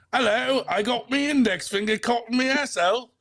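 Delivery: high, mocking British accent. American mocking his British rival in a British accent. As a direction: ham up a cheeky British voice. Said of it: "That's not a bad accent".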